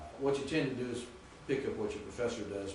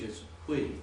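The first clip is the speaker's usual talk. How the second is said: normal talking voice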